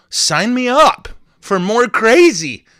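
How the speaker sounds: a big, silly, drawn-out cheer; mock crazy cheer. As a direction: belt it out like a goofy volunteer. Jokey enthusiastic outburst.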